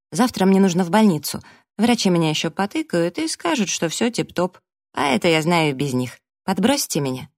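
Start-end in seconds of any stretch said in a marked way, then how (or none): none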